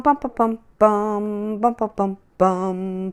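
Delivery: usual speaking voice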